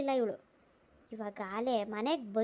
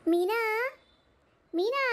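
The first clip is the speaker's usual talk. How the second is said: calling out a name; 7 semitones higher than usual. Acted. Woman at the door calls out to the house, raised voice. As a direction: call Meena from outside the door.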